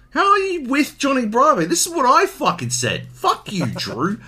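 high, loud indignant outburst; hammed-up offended yelling, breaking into a laugh; mock outrage. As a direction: protest loudly, pitch shooting up. Mock outrage at listener's message.

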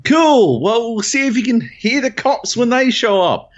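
high, loud retort hurled through a window; yelling out. Retold or imitated. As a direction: call it out loudly, high-pitched. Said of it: "So I yell out".

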